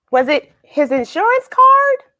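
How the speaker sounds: high, bright mock question; mock-surprised questioning; playful guess. A narrator's reading. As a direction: tease the kids with a silly guess.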